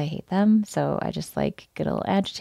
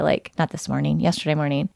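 ordinary delivery

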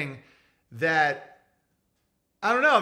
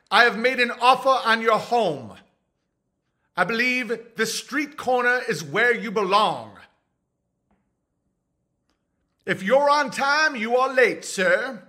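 louder, higher formal voice; addressing someone as sir, stiffly raised; mock haughty gentleman; 6 semitones higher, 4 dB louder than usual. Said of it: "I'm like".

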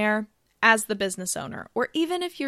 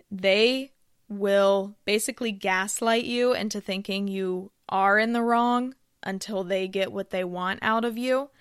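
usual speaking voice

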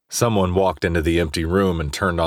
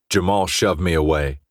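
plain reading voice

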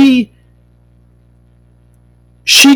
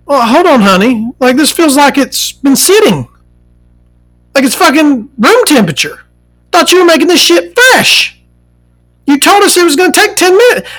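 indignant complaint; 8 semitones higher than usual. Retold or imitated. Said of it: "I'm like".

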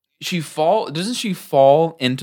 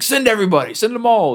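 commanding Jareth; 9 semitones higher than usual. Retold or imitated. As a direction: order the whole army out. Jareth orders goblins out.

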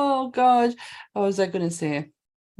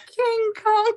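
high mock call; 12 semitones higher than usual. Joking name call.

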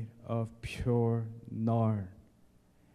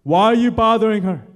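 commanding; 10 semitones higher, 12 dB louder than usual. Acted. Jesus rebukes the men.

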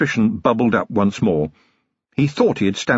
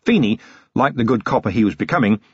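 usual speaking voice

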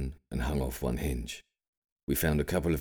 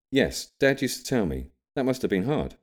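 put-on higher, louder young man's voice; young William; 9 semitones higher, 4 dB louder than usual. A narrator's reading. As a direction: voice the grandson, bright and eager. William's voice in story.

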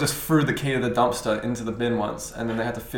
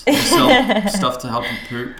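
laughing; 7 semitones higher, 5 dB louder, slower than usual. Real, not acted. Joking, laughing through it.

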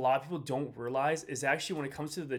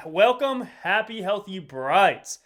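excited intro; 6 semitones higher, 9 dB louder, much slower than usual. Real, not acted. Podcast intro greeting.

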